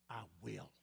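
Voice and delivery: soft, low, almost to oneself: "I will"; quiet vow. Acted. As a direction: murmur "I will" like a private promise. Sermon repeats the vow.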